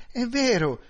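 high, loud startled cry. Acted. The widow answering Noonan about the oven, loud and startled. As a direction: jolt of remembering the meat.

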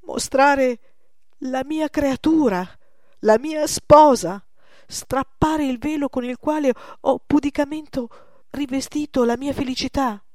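high, loud, impassioned protest; anguished outcry. A narrator's reading. Old master refuses to unveil his beloved creation, anguished. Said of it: "gridò infine con angoscia".

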